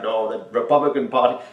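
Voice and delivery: badly faked Austrian accent; Arnold Schwarzenegger accent. Interviewee mimicking the star's reply, bad Austrian accent. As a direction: do a comic Austrian movie-star accent. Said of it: "Arnold Schwarzenegger accent".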